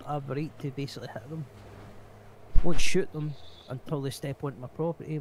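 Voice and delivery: ordinary talk, nothing marked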